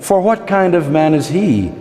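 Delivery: measured recital with lifted phrase openings and falling ends; poetry reading. Acted. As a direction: recite as the mirror talking back, phrase by phrase. Reads poem voicing a mirror.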